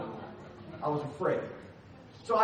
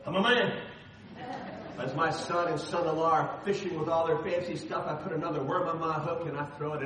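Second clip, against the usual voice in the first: ordinary talk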